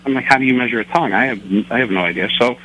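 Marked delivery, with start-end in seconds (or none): none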